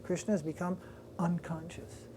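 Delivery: whispered, soft narration; a sacred hush over the fainting. A narrator's reading. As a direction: breathe it like a secret. Hushed moment in story.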